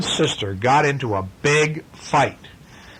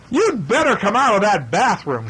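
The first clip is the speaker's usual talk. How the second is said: higher, mock-angry shout; shouting brother; 9 semitones higher, 3 dB louder than usual. Brother shouting through the bathroom door. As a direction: bang on the door with your voice. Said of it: "shouted Brother".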